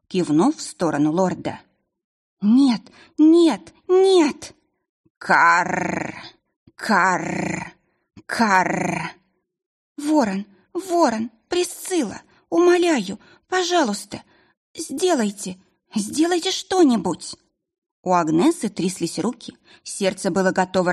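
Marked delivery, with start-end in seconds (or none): 2.4-4.5 high, loud anguished no; desperate cry. Acted. Agnes sees the lord dying. 5.2-9.1 harsh cawing bird; raven caw. Acted. 10.0-17.4 high, frantic begging; desperate pleading. Acted.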